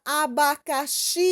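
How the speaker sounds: "abacaxi" with the final "xi" held very long; stressed final syllable. Shown as a demonstration. Teacher shows children the tônica, "xi" in "abacaxi" drawn out. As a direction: stretch out the last syllable of "abacaxi". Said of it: "a sílaba mais forte da palavra".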